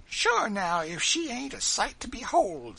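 put-on high, loud exclaiming old servant; compassionate Dinah. A narrator's reading. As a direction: exclaim with pity in a high-pitched character voice. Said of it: "said old Dinah compassionately".

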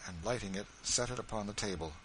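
plain reading voice

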